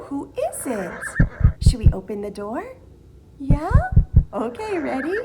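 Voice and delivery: singsong preschool teacher; reading to a toddler. Acted. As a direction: sing it out for little ones. Singsong circle time teacher.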